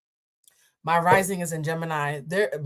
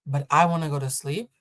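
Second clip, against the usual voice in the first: calm restraint; 6 semitones lower than usual. Retold or imitated. To the seatmate over the shade, low calm restraint. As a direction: talk her down with patience. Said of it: "I said".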